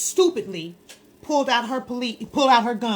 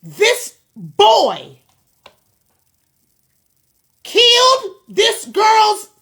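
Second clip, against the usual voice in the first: much higher and louder, slow and heavy with anger; real anger; 11 semitones higher, 11 dB louder, much slower than usual. Real, not acted. To women online about a killing, furious and near tears. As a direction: hold back tears, voice shaking with rage. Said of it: "I'm so angry".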